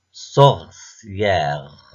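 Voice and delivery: "Sorcière" as sor, then a long cière; drawn-out "Sorcière" in two beats; split into syllables. Shown as a demonstration. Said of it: "repère le son qui se répète".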